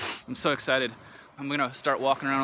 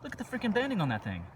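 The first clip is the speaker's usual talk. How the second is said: excited; 8 semitones higher, 3 dB quieter than usual. Real, not acted. Thrilled at banded agate find.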